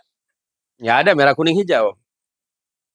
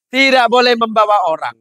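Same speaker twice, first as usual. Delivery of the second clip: stern policeman; 7 semitones higher, 4 dB louder than usual. Acted. Policeman repeats rule.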